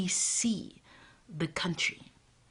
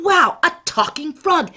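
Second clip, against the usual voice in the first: loud, higher, marvelling wow; delighted wow; 11 semitones higher, 12 dB louder than usual. Acted. Old man marvelling at the frog, loud delighted wow. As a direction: savour the wonder out loud.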